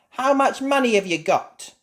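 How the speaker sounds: put-on high, loud boy; little pony voice. A narrator's reading. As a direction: ask like a shopkeeper kid. Pedro asks.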